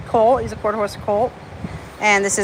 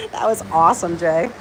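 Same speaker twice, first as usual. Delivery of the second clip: ordinary talk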